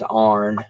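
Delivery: "arn" as one syllable, ahrn; "arn" for iron. Retold or imitated. Imitating his dad saying iron as "arn". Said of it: "the way he said iron".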